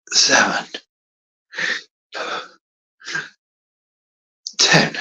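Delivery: counting reps while exercising; strained counting between push ups; doing push ups. Real, not acted. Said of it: "Now I'm gonna do ten more".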